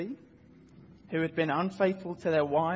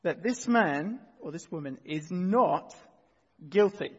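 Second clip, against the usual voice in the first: loud, slow, weighty courtroom declaration; booming authoritative judge; 4 dB louder, slower than usual. Acted. Judge pronouncing the verdict to the accused in court, loud and booming. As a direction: proclaim it loudly and slowly as the judge. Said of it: "he says in his loud, booming, authoritative voice".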